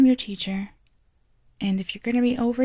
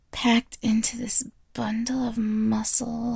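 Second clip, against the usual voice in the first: slow hushed whisper; slower than usual. Acted. Teacher confiding to her aide, slow whisper. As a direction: drop to a slow breathy whisper.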